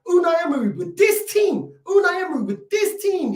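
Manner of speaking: high, insistent push on "this team"; stress on THIS TEAM. Real, not acted. Fan arguing Emery managed better, "this team" stressed high. Incredulous argument about old lineup.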